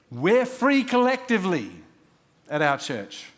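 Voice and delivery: high, loud rising proclamation; preached shout. Real, not acted. Pastor proclaiming freedom to the congregation, loud and high. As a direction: lift the church with a ringing cry.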